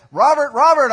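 loud, high call of a name. Pretend. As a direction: call down from above. God calling preacher's name.

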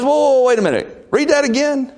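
high, loud whoa whoa wait a minute; sudden alarmed king; hammed-up startled king. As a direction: stop them mid-read, astonished. King voiced, surprised.

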